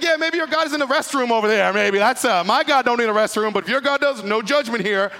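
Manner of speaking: loud, high teasing jab; mocking trash talk. Elijah mocking the prophets of Baal, loud and teasing. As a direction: rib them like a smug rival. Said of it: "He's like".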